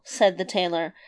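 ordinary delivery

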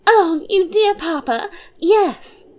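put-on high, bubbly girlish voice; a light, giddy young princess. Princess teasing her papa and mamma, high and bubbly.

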